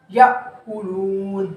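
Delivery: याकुलून stretched very slowly; slow recitation. Shown as a demonstration. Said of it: "अलिफ नहीं पढ़ना बल्कि हमज़ा पढ़ना है".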